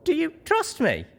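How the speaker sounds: high, earnest plea; anxious question. A narrator's reading. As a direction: lean on trust, needing an answer. Young pony anxiously asking zebra.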